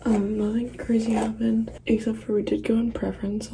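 hushed, raspy whisper. Real, not acted. Whispering vlog early morning.

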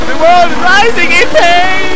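high, slow dramatic wail; anguished cry. Acted. Player lamenting the destruction, anguished drawn-out wail. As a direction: stretch it into a lament.

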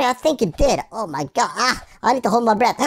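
normal talking voice

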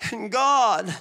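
pleading self-pity, put on; whining. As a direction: whine it out like a sulky child. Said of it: "it's that whining tone".

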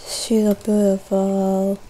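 soft, slow, mock grieving; hammed-up quiet lament; mock mourning.

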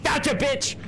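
high excited shouting; triumphant. Real, not acted. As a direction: cheer the catch. Triumph after catching creature.

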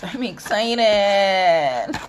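loud, high and very drawn out; excited. Shown as a demonstration. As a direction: stretch every syllable with glee.

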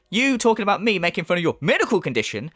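usual speaking voice

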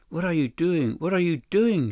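high-pitched, alarmed protest; narrated woman's shocked outcry, pitched well up; horrified cry. A narrator's reading. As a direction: cry out in horror without shouting. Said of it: "she cried in horror".

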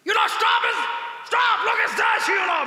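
high, loud, slow English yelling; mock foreign tourist shout. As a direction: shout stop like a startled foreigner. (ગભરાયેલા વિદેશી પ્રવાસીની નકલમાં બૂમ)

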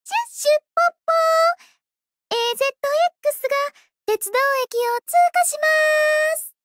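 high, loud choo-choo and mock announcement; playful train imitation. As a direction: play at being a train for fun. Pretends to be a train. (高く大きな声で汽車ごっこ)

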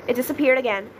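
ordinary delivery

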